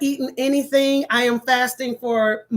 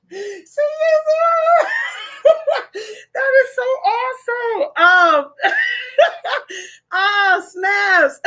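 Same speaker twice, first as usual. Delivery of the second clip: high words between cackles; delighted, nearly crying with laughter; overcome laughing; 10 semitones higher than usual. Real, not acted. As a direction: squeal it through happy laughter.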